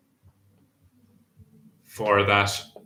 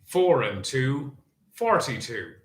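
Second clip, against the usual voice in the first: bingo caller; 3 semitones higher than usual. Acted. Bingo number calling.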